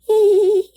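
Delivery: high, loud laugh; teacher laughs at the children's joke; bright burst of laughter. A narrator's reading. Teacher laughs at class comment.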